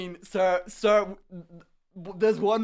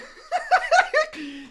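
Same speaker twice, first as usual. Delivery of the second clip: very high burst of laughter; high shrieking laugh; 5 dB louder than usual. Real, not acted. After saying Minecraft roleplay, shrieking with laughter. Explodes laughing at his answer.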